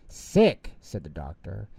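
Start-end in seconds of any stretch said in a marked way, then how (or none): 0.1-0.7 high, loud eager question; surprised echo. A narrator's reading. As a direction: pounce on the word. Doctor eager.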